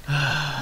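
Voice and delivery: audible, acted sigh; storybook sigh of resignation; weary sigh. A narrator's reading. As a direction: sigh out loud. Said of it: "Ned and Lorenzo sighed".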